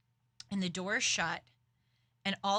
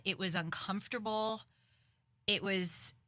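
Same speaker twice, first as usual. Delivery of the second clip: usual speaking voice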